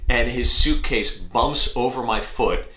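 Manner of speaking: ordinary talk, nothing marked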